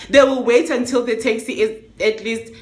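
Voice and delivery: ordinary talk, nothing marked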